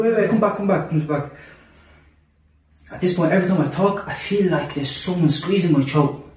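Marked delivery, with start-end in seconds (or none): 0.0-1.3 higher-pitched retold plea to come back; quick, pleading re-enactment; urgent plea. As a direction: call after someone in mild panic. Retold panicked calling after someone.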